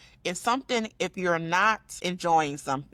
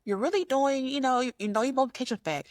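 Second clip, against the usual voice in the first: high bright praising teacher voice, higher; teacher praise; 9 semitones higher than usual. Retold or imitated. Teacher complimenting a student's multiplication, high encouraging voice. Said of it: "You're like".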